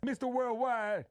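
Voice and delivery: high, loud, slow [name]-style call; hype man shout. Acted. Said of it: "You say it".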